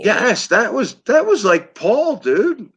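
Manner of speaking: mock Liverpool accent. Recognising early [name] in guest's Scouse, high Liverpool accent. Delighted reply mimicking the Beatle accent.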